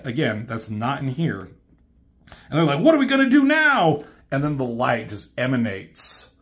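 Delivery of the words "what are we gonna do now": high, fast and loud alarm; high cartoon panic; panicked question. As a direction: blurt it in sudden panic. Despair as Unicron survives.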